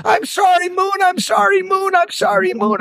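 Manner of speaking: high, pleading elephant cries; panicked high sorry; frantic apology. A narrator's reading. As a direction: beg the moon's forgiveness in a high voice. Elephant apologizing to angry moon.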